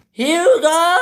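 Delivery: high, loud sung-out call; shout. A narrator's reading. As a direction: call across the yard. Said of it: "shouted Sir Topham Hatt".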